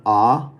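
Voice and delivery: ah said with jaw open, lips unrounded. Shown as a demonstration. Teacher demonstrating the unrounded ah sound. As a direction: drop the jaw and say ah. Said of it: "use this ah down here that's unrounded".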